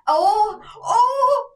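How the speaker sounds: loud, high panicked ohs; mock panic. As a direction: imitate her flustered panic, high and loud. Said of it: "She panics".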